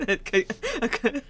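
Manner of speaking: laughing. Real, not acted. Laughing mid-word.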